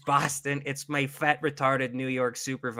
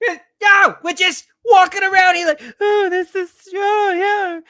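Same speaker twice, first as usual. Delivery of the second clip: high, loud Boston rant; Bill Burr; 7 dB louder than usual. Acted. Podcaster doing an impression for co-host, yelling Boston rant. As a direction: yell it like an exasperated Boston comic. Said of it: "Bill Burr!".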